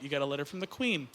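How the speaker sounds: low, soft, matter-of-fact; understated confirmation. Shown as a demonstration. Comic confirming the crowd's answer, low and understated. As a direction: drop low and quiet to confirm it.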